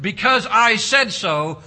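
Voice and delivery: loud, slow stern parent; mock parent retort. Parent ending a child's question, loud mock retort. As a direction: lay down the law.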